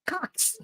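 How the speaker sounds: put-on high, soft little-girl "Cocks" for socks; child's mispronunciation. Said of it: "She can't pronounce it".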